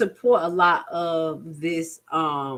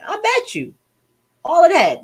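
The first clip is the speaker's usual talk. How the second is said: mock outburst; 12 semitones higher, 7 dB louder than usual. Real, not acted. Mocking outburst at rapper.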